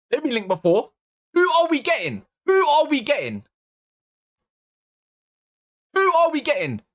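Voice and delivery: high, frantic questions; rising panic over the shortlist; panicked rant. Real, not acted. Fan panicking to viewers about signings, high frantic.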